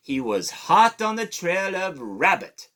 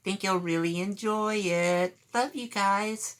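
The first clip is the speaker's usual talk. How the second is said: high puppet voice; 6 semitones higher, 4 dB quieter than usual. Acted. Puppet Daniel greets kids.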